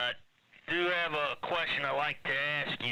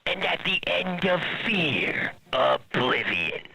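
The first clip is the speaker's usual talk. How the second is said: slow, eerie scarecrow impression; 3 semitones higher, 4 dB louder, slower than usual. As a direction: drag it out like a haunted scarecrow. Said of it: "my impression of a scarecrow".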